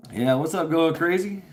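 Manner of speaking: cheery hello. Real, not acted. Greeting a viewer on stream.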